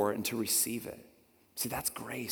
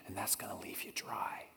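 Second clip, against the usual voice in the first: hushed whisper; 6 semitones lower, 15 dB quieter than usual. Acted. Jesus voiced, sermon.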